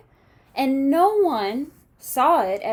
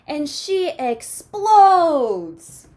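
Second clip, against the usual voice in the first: slow, louder, drawn-out explodes; explosive; 4 semitones higher, 7 dB louder, much slower than usual. A narrator's reading. Reading the angry climax to kids, slow and loud. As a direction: let it burst out like a blow-up.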